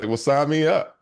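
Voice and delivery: high, loud retold burst of eagerness; eager acceptance. Client jumping at mentor's offer, eager and loud. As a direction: jump at the offer, pitch leaping up.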